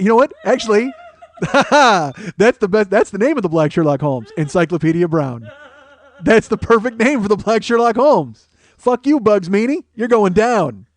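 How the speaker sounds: high and loud, laughing hype; hyping up his own joke detective, excited and laughing; excited riffing. Real, not acted. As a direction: get carried away, pumped.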